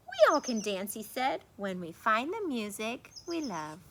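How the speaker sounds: lively storybook read-aloud; reading to children. A narrator's reading. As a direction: read it like bedtime story for kids. Reads picture book aloud.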